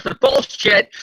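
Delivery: yelled high and loud in disbelief; indignant shout. Real, not acted. Co-host calling out the comic's lie, indignant high shout. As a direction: call it out, outraged.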